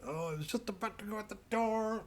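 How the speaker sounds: very high put-on whiny voice; mock fussy excuse. A viewer excusing themselves, in a very high whine. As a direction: squeak out a limp excuse. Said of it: "and it's like".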